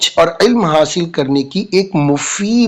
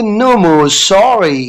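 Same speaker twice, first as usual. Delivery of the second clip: very slow "No more sorry" with a heavy no; firm refusal; 2 semitones higher, much slower than usual. Shown as a demonstration. Teacher models refusing apologies for students. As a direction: lean on each word. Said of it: "So आप बोलेंगे".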